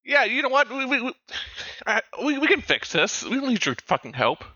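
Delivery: high-pitched, stammering defiance; acted angry argument. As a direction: stumble indignantly into a refusal. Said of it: "start yelling at him".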